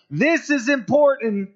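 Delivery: IMPORTANT drawn out, high and slow; emphatic. Acted. Preacher voicing what the writers' repetition signals, emphatic. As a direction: pitch "This is important" high and stretch it out. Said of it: "It's their way of saying".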